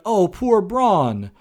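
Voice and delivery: put-on sarcastic poor-baby voice; mock pity. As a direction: coo with exaggerated, drawn-out pity. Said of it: "Nobody's gonna be like".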